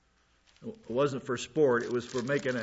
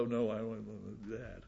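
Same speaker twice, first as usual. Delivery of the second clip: much softer, reluctant little voice; mock reluctant Peter; 5 semitones lower, 11 dB quieter than usual. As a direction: murmur it like a scared young Peter.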